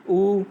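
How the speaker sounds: teacher demonstrating उ with rounded lips; demo vowel sound. Shown as a demonstration. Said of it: "जैसे उ".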